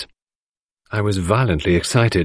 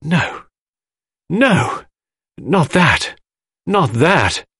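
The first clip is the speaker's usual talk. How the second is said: sudden cry; 8 semitones higher, much slower than usual. A narrator's reading. Mary crying out at the poison idea, frightened. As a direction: cry out in terror. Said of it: "with a sudden cry".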